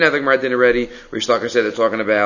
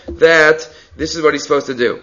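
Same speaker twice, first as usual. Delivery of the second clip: ordinary talk, nothing marked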